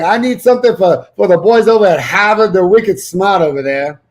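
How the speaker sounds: put-on loud, high Boston accent customer order; hammed-up Boston accent. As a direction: order donuts like a Boston local. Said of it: "It sounded like a Boston accent".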